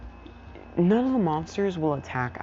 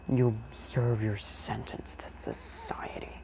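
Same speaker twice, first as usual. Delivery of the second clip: slow, whispery pronouncement; sigh, then deliver it slowly under the breath; hushed verdict; 6 dB quieter, slower than usual. Retold or imitated. Hero looks over at All For One, hushed verdict.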